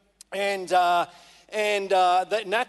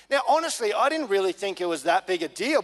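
usual speaking voice

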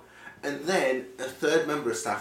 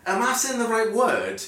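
exasperated; 9 semitones higher, 6 dB louder than usual. Retold or imitated. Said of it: "exasperated retelling".

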